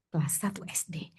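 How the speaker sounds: hushed echo of Kelas satu S D; whispered repeat. Shown as a demonstration.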